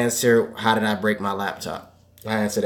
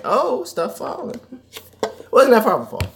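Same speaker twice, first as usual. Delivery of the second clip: mock high exclamation; 12 semitones higher than usual.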